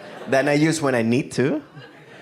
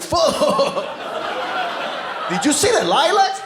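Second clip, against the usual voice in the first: mock excited guest; 12 semitones higher than usual. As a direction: gush over the flowers like they're the best drug. Mock party guest raving about flowers.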